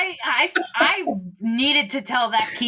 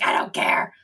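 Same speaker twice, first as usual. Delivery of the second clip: whispered defiance. Retold or imitated. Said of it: "And I'm like".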